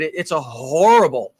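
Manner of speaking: normal talking voice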